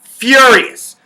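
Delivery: shouted furious. Shown as a demonstration. Playing a PSG supporter, shouting the word furious. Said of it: "I'm fucking furious".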